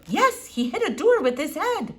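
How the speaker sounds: a hint of an old eccentric doctor; Doctor Measham. A narrator's reading. Old doctor peering at the squirrel, lightly foreign.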